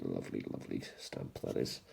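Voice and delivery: hushed, breathy admiring whisper; softly whispered aside over a lovely stamp. Real, not acted. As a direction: whisper it to yourself, fondly.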